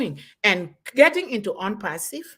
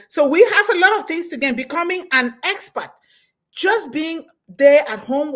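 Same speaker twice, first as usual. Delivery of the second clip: much higher and louder, rallying; jubilant, raised-voice rally cry; excited burst; 6 semitones higher, 7 dB louder than usual. Real, not acted. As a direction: fire up the crowd with this line. Rousing the audience.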